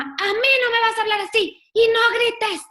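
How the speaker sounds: high, loud imitated scolding; mother yelling. A mother scolds her shouting child, loud high imitated yell. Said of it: "gritó más fuerte".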